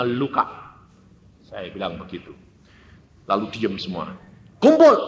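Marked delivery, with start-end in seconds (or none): 4.6-5.0 loud, high, abrupt command; retold calling out. Child summoning siblings to pray, loud command. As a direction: call everyone together sharply.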